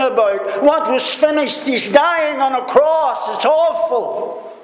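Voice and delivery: high-pitched, baffled questioning; agitated bystander, pitched well up; bewildered onlookers. Acted. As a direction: act out onlookers questioning each other in disbelief. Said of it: "Someone standing there could well have said".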